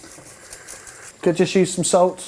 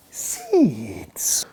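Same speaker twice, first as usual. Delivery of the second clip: whisper; 6 semitones higher, 4 dB quieter than usual. Acted. Whispered word.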